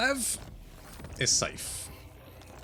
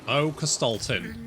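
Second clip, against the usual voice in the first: normal talking voice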